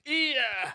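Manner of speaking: high, drawn-out falling shriek; acted shriek of pain. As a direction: shriek as the lash strikes. Bandit shrieks under the lashes. (दर्द भरी लंबी ऊँची चीख)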